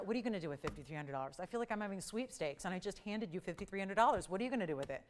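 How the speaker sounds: normal talking voice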